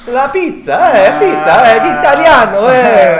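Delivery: high, loud hammed-up Italian accent. Showing off the pizza to viewers, mock Italian accent. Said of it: "With the Italian accent".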